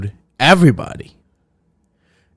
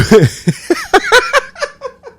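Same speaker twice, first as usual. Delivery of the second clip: high burst of laughter. Real, not acted. Laughs at own bit.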